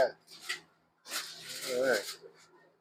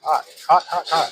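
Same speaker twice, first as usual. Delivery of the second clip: hot food in mouth, huffing; talking around scalding bite; mouth full of hot food; 2 semitones higher, 6 dB louder than usual. Real, not acted. Tasting hot food, gasping.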